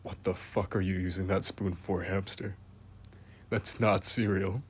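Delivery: quietly appalled, as if catching it in the act; hushed disbelief. Real, not acted. Narrator catching the hamster misusing a spoon, quiet disbelief. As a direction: drop to a low, quiet, incredulous hiss.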